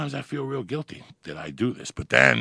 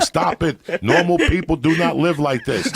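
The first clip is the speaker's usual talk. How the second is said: retold yell; 4 semitones higher, 8 dB louder than usual. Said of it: "and he's yelling".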